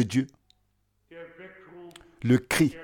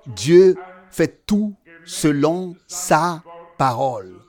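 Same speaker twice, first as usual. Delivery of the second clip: slow, drawn-out proclamation with raised voice; emphatic preaching; 3 semitones higher, 3 dB louder, slower than usual. Acted. Preacher declaring that God does all his way, slow and emphatic. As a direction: weigh out each phrase with conviction.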